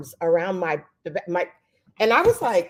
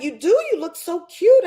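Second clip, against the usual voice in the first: higher, louder insisting praise; emphatic compliment; 10 semitones higher, 7 dB louder than usual. Retold or imitated. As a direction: insist warmly that she does look cute. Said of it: "I was like".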